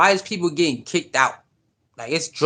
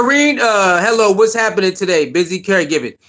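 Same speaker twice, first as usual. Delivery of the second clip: ordinary talk, nothing marked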